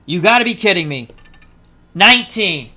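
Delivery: real, loud, incredulous outburst; loud disbelief. Real, not acted. Player reacts to an unlikely dice roll, loud disbelief. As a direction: blurt it out, stunned by the roll.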